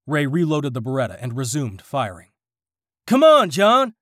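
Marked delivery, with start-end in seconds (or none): 3.1-4.0 high, loud urgent scream. A narrator's reading. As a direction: scream for him to hurry. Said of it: "screamed Ray".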